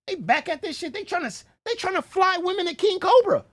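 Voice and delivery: high-pitched, exclaimed disbelief; incredulous outcry. Real, not acted. Reacting to women being flown to King Cobra, high incredulity. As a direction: pitch it way up in scandalised amazement.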